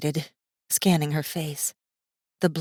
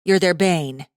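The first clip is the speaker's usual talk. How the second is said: louder, lightly voiced young woman; girl rephrasing; 2 semitones higher, 4 dB louder, slower than usual. Girl finding a word Masaaki knows, lightly voiced. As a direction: try another word, patiently.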